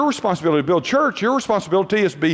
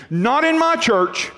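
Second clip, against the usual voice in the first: imitating an angry woman; 7 semitones higher, slower than usual. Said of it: "she was angry at something we were doing".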